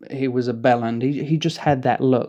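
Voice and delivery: ordinary delivery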